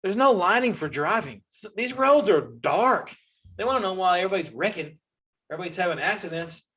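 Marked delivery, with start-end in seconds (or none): none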